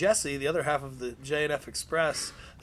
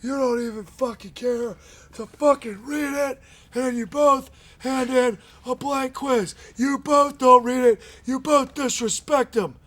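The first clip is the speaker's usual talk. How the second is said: slow, higher, loud angry boss rant; furious rant; 7 semitones higher, 3 dB louder, slower than usual. Retold or imitated. Boss berating wrestlers over the unread book, slow furious rant. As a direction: rage at them like a boss who feels disrespected.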